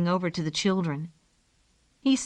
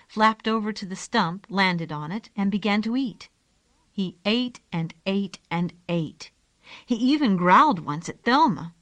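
ordinary delivery

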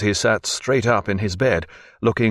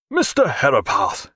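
glad cry; 4 semitones higher than usual. A narrator's reading. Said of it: "cried Jack".

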